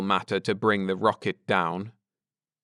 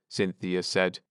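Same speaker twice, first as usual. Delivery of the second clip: usual speaking voice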